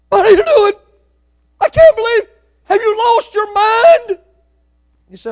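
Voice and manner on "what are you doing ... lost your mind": parent going ballistic. Retold or imitated. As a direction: explode at the kid like a parent who lost it. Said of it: "then you go ballistic on them".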